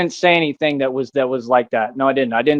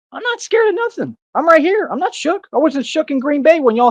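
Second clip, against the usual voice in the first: defiant outburst; 10 semitones higher than usual. Real, not acted. Ranting defiance at the company.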